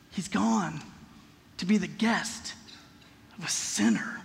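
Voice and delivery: soft mutter fading into a whisper. Acted. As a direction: grumble it under your breath to a whisper. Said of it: "They begin to mutter".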